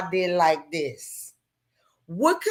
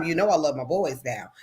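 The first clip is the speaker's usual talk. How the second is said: ordinary talk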